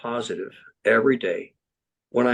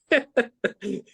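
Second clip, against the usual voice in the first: high laugh; 12 semitones higher than usual. Real, not acted. Laughs at own story.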